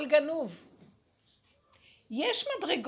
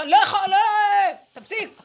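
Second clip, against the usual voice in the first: louder, higher pleading cry; Judah pleading; 8 semitones higher, 12 dB louder, faster than usual. Acted. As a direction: beg him to stop, at the end of strength. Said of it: "יהודה עוד אומר".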